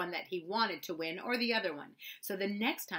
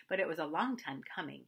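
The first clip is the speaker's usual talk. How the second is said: ordinary delivery, nothing marked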